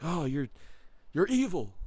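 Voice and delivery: high, loud mock gasping accusation; mock scandalized accuser. Critics calling the storyteller evil, mock scandalized. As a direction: imitate someone appalled. Mimics people condemning him.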